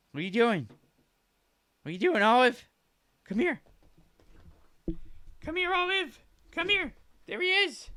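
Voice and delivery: very high cooing pet talk. Real, not acted. Baby talk to dog.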